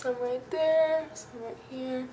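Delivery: talking with cheeks sucked in. Real, not acted. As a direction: speak while sucking in your cheeks. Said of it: "Suck in my cheeks".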